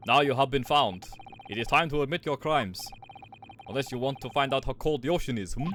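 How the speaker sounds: menacing mock Russian accent; terrible Russian accent. Sailor demanding a confession with threats, terrible Russian accent. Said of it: "all getting this fucking terrible Russian accent".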